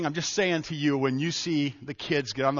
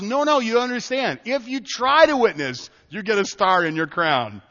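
higher, louder mom gently setting her son straight; mom correcting; 7 semitones higher, 7 dB louder than usual. Retold or imitated. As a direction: reassure the boy, a hint of a firm mother. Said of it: "my mom says".

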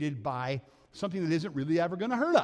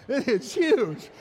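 louder, higher, laughing through it; laughing disbelief; 7 semitones higher, 7 dB louder than usual. Retold or imitated. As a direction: laugh at how big the dog is. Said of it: "And I'm like".

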